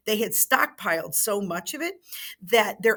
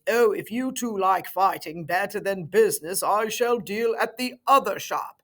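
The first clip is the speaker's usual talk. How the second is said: lightly voiced English gentleman, a bit louder; John Bull voice; 4 dB louder than usual. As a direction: play a haughty English shopkeeper. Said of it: "Mister Bull says".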